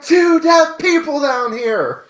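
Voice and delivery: high, loud laughing yell of a movie line; acted shout. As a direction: shout it in alarm through a laugh.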